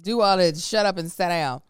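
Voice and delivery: loud, emphatic telling-off; mock parental order. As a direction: pile on the playful scolding, loudly. Continuing the mock scolding.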